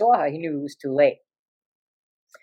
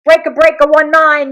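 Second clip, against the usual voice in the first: loud, higher-pitched radio hail; imitated trucker's radio hail; CB radio call; 7 semitones higher, 9 dB louder than usual. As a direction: call out over the CB. Mimics the CB radio calls.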